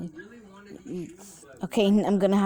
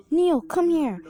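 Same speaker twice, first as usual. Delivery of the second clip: higher-pitched call to a friend; 9 semitones higher, 3 dB louder, slower than usual. Acted. Nikki calls Neil to come here, calling out. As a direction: call someone over, pitched up.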